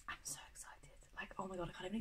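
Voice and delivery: tiny, barely-there thrilled whisper; excited whisper. Real, not acted. Confiding excitement about Bali to viewers, whispered. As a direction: whisper it as if overcome.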